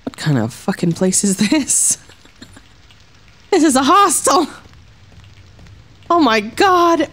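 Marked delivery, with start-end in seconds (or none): 3.5-7.1 outraged surprise. Real, not acted. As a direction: yell it in disbelief. Outraged discovery.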